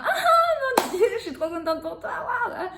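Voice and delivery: high, loud and laughing with delight; joyful outburst. Shown as a demonstration. Coach demonstrating joy to her patients, high and laughing. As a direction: burst out with overjoyed laughter. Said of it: "si je veux exprimer de la joie".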